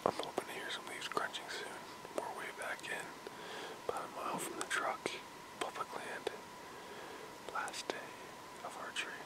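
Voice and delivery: hushed hunter's whisper. Real, not acted. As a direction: whisper so the deer won't hear. Hunter whispers in tree stand.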